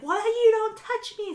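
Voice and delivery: high, imitated yell at the worker; mock shout. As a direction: shout it back in a hammed-up voice. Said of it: "she's shouting at this guy".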